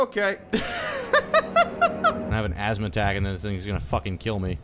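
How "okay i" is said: laughing it off after the scare; shaky relieved laughter; breathless laugh. Real, not acted. Player catching his breath after the chase, laughing.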